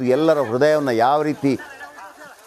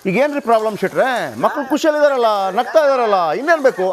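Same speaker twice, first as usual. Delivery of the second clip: mocking careless politician; 7 semitones higher than usual. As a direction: sneer it like an official who shrugs off the kids. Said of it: "ಈಗ ನಮ್ಮ ರಾಜಕಾರಣಿಗಳು ಹೆಂಗಿದ್ದಾರೆ ಅಂತಂದ್ರೆ".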